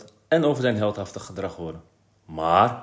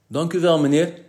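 higher, polite little thanks; slightly bashful, raised voice; a bit shy; 6 semitones higher, 6 dB louder than usual. A narrator's reading. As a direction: thank the headmaster with mild embarrassment. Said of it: "zei Kees een beetje verlegen".

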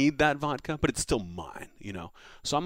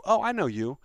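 cocky taunt; 8 semitones higher, 4 dB louder than usual. Retold or imitated. Retold taunt to Tony Romo.

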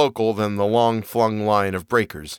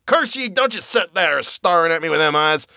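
lightly voiced frenzied old sailor; crazed old man, wild and cackling; screaming old man; 4 semitones higher than usual. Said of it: "Zadok was really screaming now".

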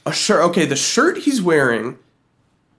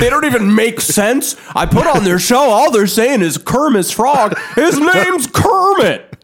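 higher, laughing mock outrage as a talk-show host; 7 semitones higher, 3 dB louder than usual. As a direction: lampoon a scandalised morning host, half laughing. Mocking TV hosts in pretend critique.

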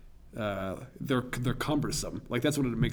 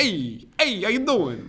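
put-on higher, hammy Brooklyn accent greeting; badly faked Brooklyn accent, pitched up; 9 semitones higher, 4 dB louder, slower than usual. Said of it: "I was trash just now".

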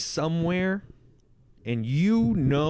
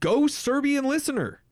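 high excited cheer; 10 semitones higher than usual. Real, not acted. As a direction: cheer them on, pitched way up. Cheering for foreign listeners.

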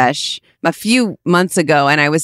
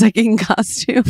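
ordinary delivery

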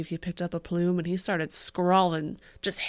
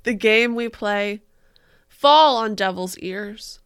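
mock dramatic poetry reading; 8 dB louder than usual. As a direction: ham up the tragic verse.

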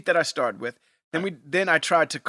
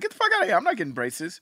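defiant refusal; 6 semitones higher, faster than usual. Retold or imitated. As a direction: refuse like a stubborn kid. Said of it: "I was like".

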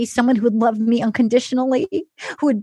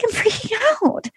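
high, mock-panicked wail; tearful freak-out; 9 semitones higher than usual. Retelling her meltdown on the show, mock freak-out. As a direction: imitate a woman losing it, voice way up. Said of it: "She's crying".

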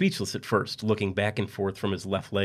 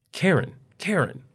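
much higher, insistent calls; calling out; 9 semitones higher, slower than usual. Acted. Husband in bed calling his wife insistently. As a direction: call her name from the bed.

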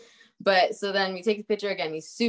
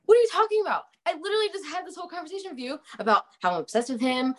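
high, fast, exasperated rant; exasperated outburst; 10 semitones higher, much faster than usual. Retold or imitated. Retelling her outburst at the studio guy, high and fast disbelief. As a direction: pitch it up and rattle it off in disbelief.

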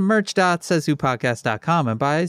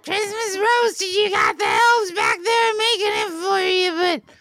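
excited elf voice; 10 semitones higher than usual. Acted. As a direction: gush like an overexcited elf. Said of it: "you know me, Santa".

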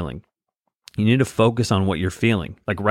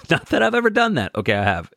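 laughing through it, much higher; laughing confession; 8 semitones higher, faster than usual. Real, not acted. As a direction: giggle out a sheepish admission. Joking admission about screaming.

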